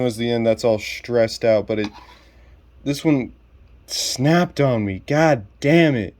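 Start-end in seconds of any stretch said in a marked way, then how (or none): 2.8-6.1 higher, exasperated curse; real frustration. Real, not acted. Reviewer cursing his snapped toy, higher and exasperated. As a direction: let the anger out at the broken piece. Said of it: "I'm so pissed now".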